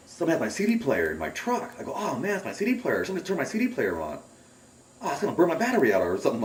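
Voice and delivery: high, quick mock-worried exclamations; retold alarm. Retold alarm at noise outside.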